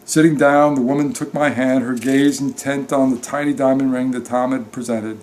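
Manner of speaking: ordinary delivery, nothing marked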